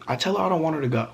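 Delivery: usual speaking voice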